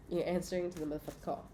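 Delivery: "You're answering to the motherfucking call" rattled off quickly; fast title read. Shown as a demonstration. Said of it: "That's the title of the reading".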